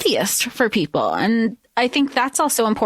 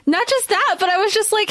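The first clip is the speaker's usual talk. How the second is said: normal talking voice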